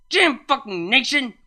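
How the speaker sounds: high, loud screech; screeching curse. Acted. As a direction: screech it in a panic. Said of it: "Vinyl screeched".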